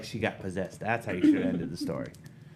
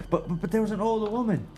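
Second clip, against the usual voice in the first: higher, halting stammer on the buts; stammering fear; 11 semitones higher, faster than usual. Acted. Millie insisting to the parents about the old woman, stammering. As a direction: trip over the words, scared.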